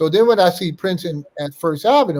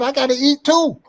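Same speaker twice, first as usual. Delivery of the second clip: heated insistence; 9 semitones higher than usual. Retold or imitated. Arguing with Prince.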